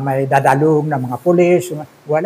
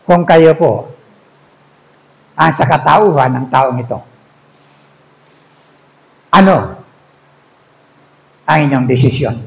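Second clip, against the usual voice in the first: solemn prayer; 3 semitones lower than usual. Acted. Preacher asking the congregation to choose, then praying, solemn.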